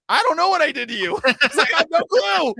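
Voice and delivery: high, loud flustered protest; panicked. Retold or imitated. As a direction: babble it out in mock panic. Retold own panicked reply.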